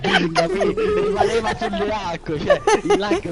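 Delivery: high, slow, laughing. Real, not acted. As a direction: laugh the joke out. Joking about lagging Jesus.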